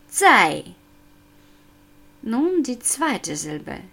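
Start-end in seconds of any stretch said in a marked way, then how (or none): 0.1-0.7 "Zai" with a steep fourth-tone drop; sharp falling tone. Shown as a demonstration. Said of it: "Vierter Ton, Fallton, scharf von oben nach unten".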